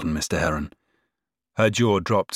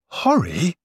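high, shocked gasp of a name; 10 semitones higher than usual. A narrator's reading. Sister shocked at the youngest's idea, gasping. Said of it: "gasped Charlotte".

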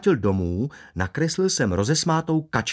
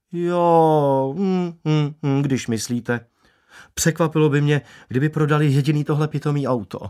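higher, louder-starting, opinionated barber; blunt barber; 5 semitones higher, 3 dB louder than usual. Acted. Barber dismissing a new car to a customer, bluntly. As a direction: scoff at the car in a pitched-up voice. Barber figure voiced beside narration.

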